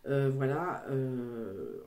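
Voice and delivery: normal talking voice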